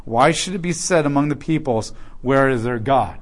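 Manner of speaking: loud, ringing reading of scripture; declaimed Bible reading. Acted. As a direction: proclaim the verses to the congregation. Reads Joel passage aloud.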